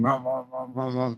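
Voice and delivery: soft low engine rumble; idling engine. Shown as a demonstration. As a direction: hum like an idling motor. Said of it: "my car is just really low".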